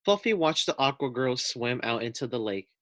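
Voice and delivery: ordinary delivery, nothing marked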